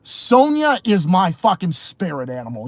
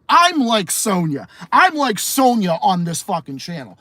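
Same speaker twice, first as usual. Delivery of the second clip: ordinary delivery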